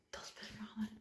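unvoiced whisper. Real, not acted. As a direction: whisper the last word. Whispered word.

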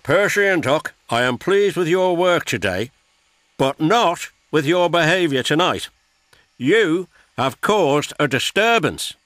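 a boss scolding his engines; stern boss. A narrator's reading. Fat Controller reprimands engines.

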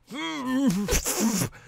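high, strangled cry with a mouth stuffed full; hammed-up muffled yelp; mock cry. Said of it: "cried out the husk general".

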